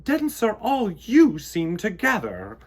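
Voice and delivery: high, loud, fussing queen; queen bee fussing at a clumsy worker; fussy queen bee. A narrator's reading. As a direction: scold the bee for his empty bucket. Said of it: "Queen Bee would fuss".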